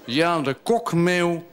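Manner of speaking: solemn verse delivery; poetry recitation. Acted. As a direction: recite it line by line with pauses. Recites his poem about a gull.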